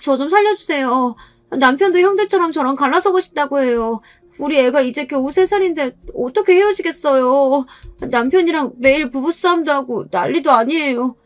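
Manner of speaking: put-on high, tearful pleading voice of a young wife; begging sister-in-law. (높고 애원하는 막내 동서 목소리)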